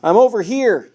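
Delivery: high, loud call to someone far off; yell. A narrator's reading. Suspect calls to a fake partner, loud yell. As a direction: call out to fool the policeman. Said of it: "he yelled".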